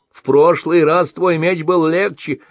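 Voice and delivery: high, loud tearful grumble; grumbling with tears. A narrator's reading. Merchant weighs gold and grumbles tearfully. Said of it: "ворчал со слезами в голосе".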